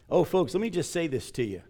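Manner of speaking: ordinary talk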